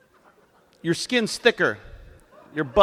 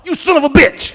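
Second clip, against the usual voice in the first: high, loud indignant yell; mock outrage; 13 semitones higher, 12 dB louder than usual. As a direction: shout at the dog who outwitted you. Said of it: "And I was like".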